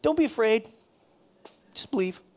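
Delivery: high, breezy, careless comfort; flippant reassurance. Shown as a demonstration. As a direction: say it like a hollow cliche. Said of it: "Just to say it like that".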